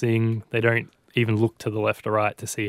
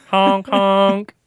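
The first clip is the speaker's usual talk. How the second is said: loud high honking noises; silly clown; 9 semitones higher, 6 dB louder than usual. Retold or imitated. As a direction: honk like a goofy car horn. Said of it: "what you wanna make the sound effect".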